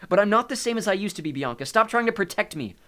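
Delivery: high, loud protest; heated protest. A narrator's reading. Nico argues with his protective sister, loud and heated. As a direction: push back against being protected.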